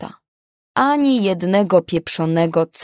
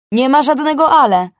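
sharp loud retort, much higher; angry cut-off; 7 semitones higher, 7 dB louder than usual. Acted. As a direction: slam the door on her protest. Boss cuts off protest angrily.

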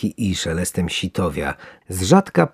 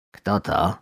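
curious; 4 dB quieter than usual. Acted. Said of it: "spytała z zaciekawieniem druga".

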